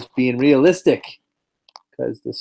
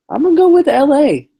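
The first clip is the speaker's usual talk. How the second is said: much higher, louder declaration; emphatic pick; 13 semitones higher, 6 dB louder than usual. Real, not acted. Host announcing his pick with a loud, high burst.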